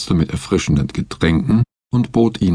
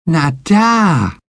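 very high, loud pointing call; high child's shout; 10 semitones higher, 4 dB louder than usual. A narrator's reading. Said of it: "rief Betsy".

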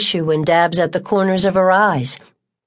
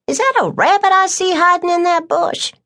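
much higher, playful mock-curious voice; joking Tucker; 13 semitones higher than usual. Tucker teasing the boy about his afro, high and sly. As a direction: tease about his hair.

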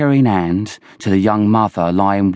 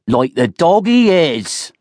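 high, slow, jeering shout; 9 semitones higher, 4 dB louder, slower than usual. A narrator's reading. Hazenby joins in against Oland, shouting. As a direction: shout it out, drawing the words out. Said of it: "shouted Hazenby".

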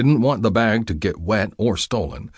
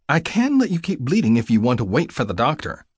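female nurse voice; 6 semitones higher than usual. Acted. Nurse voice in narrated scene.

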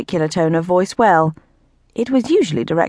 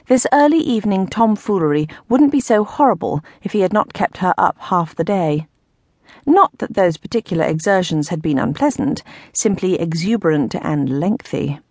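plain reading voice

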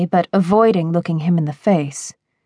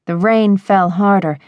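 ordinary delivery

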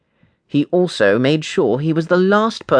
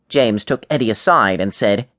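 usual speaking voice

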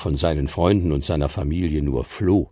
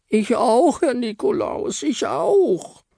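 very high, slow pleading little voice; squeaky Frau Stern; slower than usual. Acted. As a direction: plead sweetly in a tiny high voice. Frau Stern eagerly agrees.

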